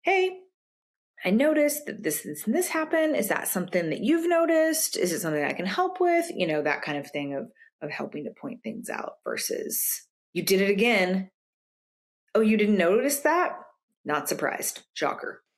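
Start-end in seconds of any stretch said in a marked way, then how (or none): 0.0-6.3 higher, friendly gentle check-in; gentle noticing. Retold or imitated. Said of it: "if you notice something". 10.3-15.4 sarcastic. Retold or imitated. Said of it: "That kind of language is not gonna help".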